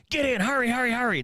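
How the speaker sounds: high, urgent getaway call; acted-out excited urging; urgent hurrying. Mom urges getaway from theft.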